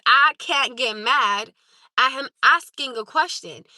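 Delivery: "I can't get mad" read out slowly, word by word, leaning on each; slow pointed reread. Shown as a demonstration. Streamer rereading a chatter's message slowly and pointedly. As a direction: spell it out slowly to prove the point. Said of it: "Again, for the second time".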